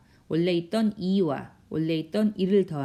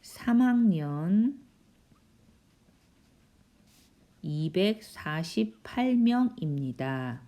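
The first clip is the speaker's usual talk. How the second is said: slow dictation with long pauses; drawn-out words, long gaps between phrases; slow writing-along pace; 2 semitones higher, slower than usual. Real, not acted. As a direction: say each phrase slowly as you write it out. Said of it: "쓰려니까 시간이 많이 걸리네요".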